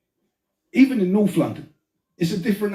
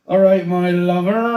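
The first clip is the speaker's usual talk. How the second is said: louder, slower Bristol "All right, my lover"; Bristol accent; 6 dB louder, slower than usual. Retold or imitated. Greeting the Bristol girl every time, Bristol accent. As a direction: greet her in a drawn-out West Country lilt. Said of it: "see a girl from Bristol".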